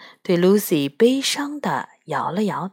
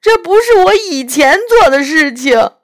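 a lightly voiced tearful faun, louder; weeping faun; 6 semitones higher, 6 dB louder than usual. Faun confessing his wickedness to Lucy, tearful faun voice. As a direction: play the ashamed faun in tears.

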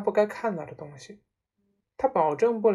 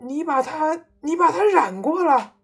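high shriek building louder; screaming accusation; 9 semitones higher, 8 dB louder than usual. A narrator's reading. Mother accuses her husband of dyeing it, screaming. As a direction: stammer into a horrified scream. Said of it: "妈妈尖叫着说".

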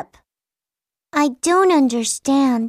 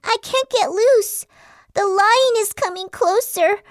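frightened; 7 semitones higher than usual. Acted. Deer trapped by its horns.

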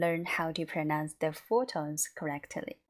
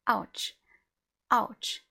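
short "Ouch" falling quickly from a higher pitch; mock pained "Ouch"; pretend hurt; 5 semitones higher, 3 dB louder than usual. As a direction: demonstrate "Ouch" with a sharp drop. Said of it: "then you will get hurt".

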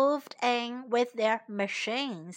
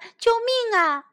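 shouting for help; 9 semitones higher, 4 dB louder than usual. A narrator's reading. Field mice call for rescue from developers, shouting. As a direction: call urgently for rescue, voice lifted. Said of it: "田鼠们喊着".

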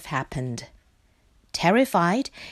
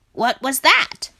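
raised, louder alarmed question; sit bolt upright and ask in alarm; startled; 5 semitones higher, 6 dB louder than usual. Acted. Duck startled by noise.

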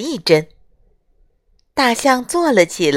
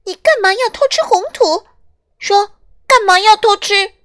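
much higher, indignant shout; angry shouting; 12 semitones higher, 4 dB louder than usual. Acted. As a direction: demand an answer angrily. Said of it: "小动物们气愤地喊起来".

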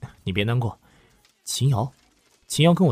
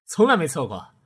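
incredulous; 11 semitones higher, 6 dB louder than usual. Acted. Coworker doubts never-wrong claim.

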